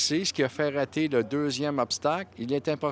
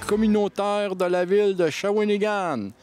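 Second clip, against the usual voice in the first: sponsor announcement; 4 semitones higher than usual. Acted. Reads the sponsor message.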